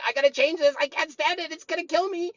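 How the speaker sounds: high, strained voice of a mind that can't take it; a frantic, whiny inner voice; panicky inner voice. Retold or imitated. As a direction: squeal it like your mind is panicking. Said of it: "your mind saying".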